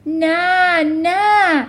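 loud, high protest; crying out. A narrator's reading. Teacher denying the headmistress's accusation, crying out. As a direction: cry out in urgent denial. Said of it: "داد زد".

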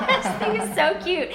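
laughing through the words, delighted. Real, not acted. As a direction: giggle it out, really amused. Laughs at cute story.

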